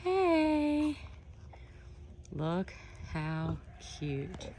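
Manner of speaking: high, gentle reassuring voice; soothing high coo. Real, not acted. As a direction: reassure her softly so she doesn't startle. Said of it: "I don't wanna startle her".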